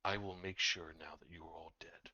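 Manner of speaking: whispered menacing threat; lich. Acted.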